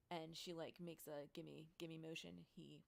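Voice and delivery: normal talking voice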